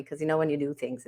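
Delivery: ordinary delivery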